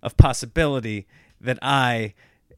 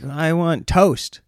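put-on slow, higher and louder little-kid demand; imitating toddler; 5 semitones higher, 4 dB louder, much slower than usual. Toddler demanding toast from dad. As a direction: draw it out like a kid wanting breakfast. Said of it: "he's like".

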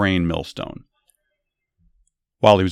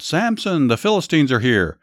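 mild narrated yell; 6 semitones higher than usual. A narrator's reading. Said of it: "Then she yelled".

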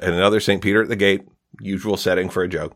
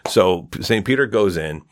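ordinary talk

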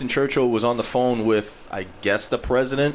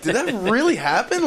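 incredulous; 10 semitones higher than usual. Real, not acted. Stunned disbelief at the president.

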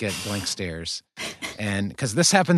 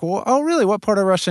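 high, loud mock-amazed librarian; surprised librarian; 12 semitones higher, 8 dB louder, faster than usual. Small-town librarian quizzing the wife about Russia, hammed-up high surprise. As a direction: play the curious librarian, eyebrows up, pitched way up.

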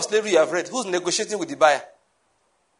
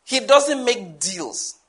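slow emphatic repeat; much slower than usual. Shown as a demonstration. Preacher repeating his point, slow and deliberate. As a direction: repeat it slower, word by word. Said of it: "I need to say that again".

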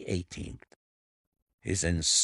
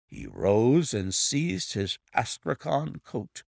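usual speaking voice

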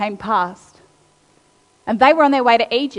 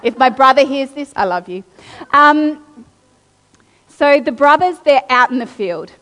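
ordinary talk, nothing marked

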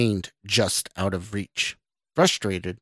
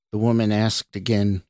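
usual speaking voice

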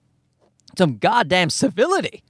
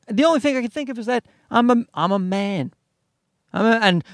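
ordinary talk, nothing marked

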